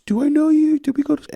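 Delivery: very high, loud placating mimicry; put-on high voice, trying to smooth things over; de-escalating police officer. Officer trying to calm an angry mom, imitated placating. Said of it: "who was trying to de-escalate".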